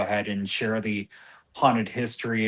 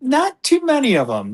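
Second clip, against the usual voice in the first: not too many, pitched up high; emphatic high; 14 semitones higher, 5 dB louder than usual. Real, not acted. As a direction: jump way up in pitch and insist.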